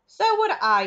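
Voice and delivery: high, loud cry; cried. Acted. Grandma Georgina cries agreement, high and loud. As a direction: join in, crying out. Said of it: "cried Grandma Georgina".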